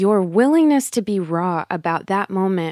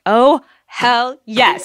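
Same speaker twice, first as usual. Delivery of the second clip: slow, louder, higher cheer; excited hell yes; 5 semitones higher, 6 dB louder, much slower than usual. Retold or imitated. Host reacting to reading the book, excited cheer. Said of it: "I was like".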